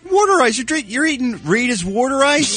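host teasing about Philly water ice, in a hammed-up Philadelphia accent. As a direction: ham up a Philly local saying water ice. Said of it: "terrible accent".

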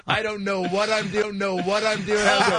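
high-pitched dawning panic; mock panic. Novice director realizing he is lost, mock panic. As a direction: let it spill out high and helpless.

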